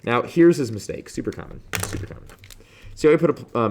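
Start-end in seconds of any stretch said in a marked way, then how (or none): 1.1-2.2 soft unvoiced aside; whispered aside. Real, not acted. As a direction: breathe it like a quick secret. Aside about common mistake.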